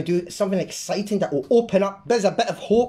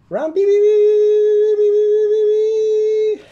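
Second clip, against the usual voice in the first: beeping sounds; 8 dB louder, much slower than usual. Pretend. Sound effects while drawing.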